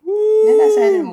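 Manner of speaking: high loud outraged cry; indignant shout. Real, not acted. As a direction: yell in mock outrage. Upset at spoiled score.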